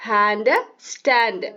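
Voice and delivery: ഹാൻഡ്, സ്റ്റാൻഡ് drawn out slowly so the -and ending matches; slow down on ഹാൻഡ്, സ്റ്റാൻഡ് to show the rhyme; slow rhyme pair. Shown as a demonstration. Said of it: "ഹാൻഡിന്റെ rhyming word".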